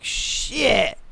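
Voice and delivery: ordinary talk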